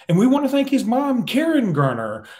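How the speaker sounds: high, loud mock broadcast call; play-by-play announcer. Play-by-play guy thanking a player's mom on air, hammed-up announcer. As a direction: imitate an announcer punching up a call. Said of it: "The play-by-play guy goes".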